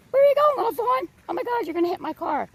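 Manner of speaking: high, loud startled cry, then fast; alarmed at the fawn. Retold or imitated. Driver retelling a fawn darting at her car, high startled squeal. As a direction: squeal out in alarm, words rushing. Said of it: "I'm like".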